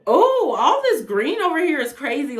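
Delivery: high, loud burst of excitement; a loud, high "Oh" of delight; excited. Real, not acted. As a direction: gush over all the green. Excited about green cards.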